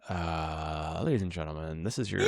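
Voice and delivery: low, soft captain's cabin announcement; lightly voiced airline captain; pilot voice. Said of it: "I all of a sudden have the perfect pilot voice".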